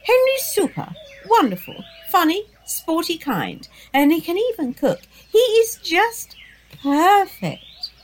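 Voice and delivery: high, breathless gush about a crush; babbling. Acted. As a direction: gush it out without stopping.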